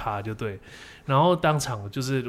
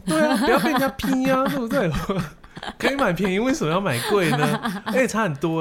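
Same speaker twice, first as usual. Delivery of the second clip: high, loud protest through laughter; laughing protest; 10 semitones higher, 4 dB louder than usual. Real, not acted. Defending why he compares prices, laughing protest. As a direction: argue back high-pitched, half laughing.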